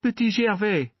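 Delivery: put-on high, open little boy's voice; a child happily giving his name; trusting boy. A narrator's reading. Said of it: "said the boy, smiling trustfully".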